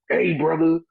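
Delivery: mock promo challenge into the mic; wrestler promo. Wrestler calling out rivals on the mic, mock challenge.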